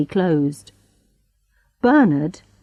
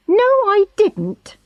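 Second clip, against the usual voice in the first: sharp, much higher retort; young woman angrily denying it; angry denial. A narrator's reading. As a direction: snap back in anger. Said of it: "said Charlotte angrily".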